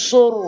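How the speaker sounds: সুর with rounded lips, full thick r; heavy sur. Shown as a demonstration. Teacher showing the heavy সুর pronunciation. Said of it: "মুখটা গোল হবে".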